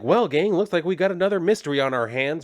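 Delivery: hammed-up cartoon sleuth announcing a mystery; mock Fred from Scooby-Doo. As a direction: play the cheesy mystery-solver, quick. [name] does Scooby Gang line.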